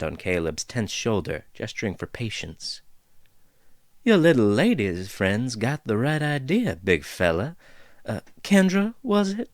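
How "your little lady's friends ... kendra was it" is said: lightly voiced folksy dwarf, amused; easygoing dwarf teasing the intruders; chatty dwarf woman. Dwarf figure speaks.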